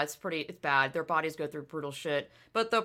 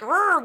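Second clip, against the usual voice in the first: loud, high mock growl; fierce growl; 10 semitones higher, 7 dB louder than usual. Showing how fierce she is when racing, mock growl. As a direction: growl like a racer.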